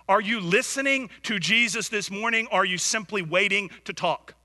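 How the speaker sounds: ordinary talk, nothing marked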